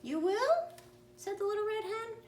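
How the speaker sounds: very high squeaky disbelief; incredulous hen. Acted. Hen mocks the lazy friends, high squeaky disbelief.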